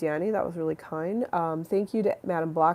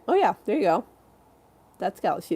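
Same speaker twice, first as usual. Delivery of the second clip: usual speaking voice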